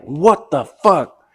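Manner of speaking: high, loud, drawn-out disbelief; incredulous. Retold or imitated. Man recalling his date's harsh remark, incredulous. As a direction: stretch it out in appalled disbelief. Retelling his shocked reaction to date.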